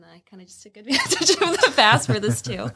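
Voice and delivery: laughing through the words; sheepishly giggled admission; amused confession. Real, not acted. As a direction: break into a real laugh while talking. Laughing at her own fasting shortcut.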